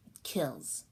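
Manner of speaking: put-on dying baby whispering his final words. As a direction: breathe the last words out. Dying baby's last words.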